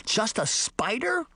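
high, slow disbelieving cry; Just a spider, can't believe it; incredulous cry. Acted. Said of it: "Sabrina cried".

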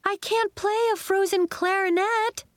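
high, sustained wail of complaint. A narrator's reading. Marigold over her ruined clarinet, wailing. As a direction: wail in high-pitched dismay. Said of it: "Marigold wailed".

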